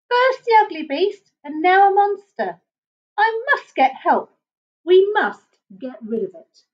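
put-on high frightened bush baby.

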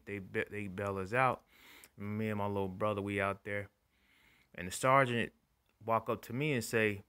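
ordinary talk, nothing marked

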